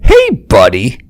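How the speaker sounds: lightly put-on "hey, buddy" greeter voice; buddy guy voice. Comic mimicking a guy he knew, lightly put-on buddy voice. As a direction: imitate an overfriendly guy's greeting, lifting at the end. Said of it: "we just do that voice".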